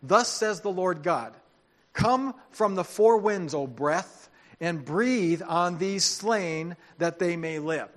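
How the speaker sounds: loud, stately reading with drawn-out phrases; scripture proclamation. A narrator's reading. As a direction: proclaim it slowly as God's command. Proclaims God's words from scripture.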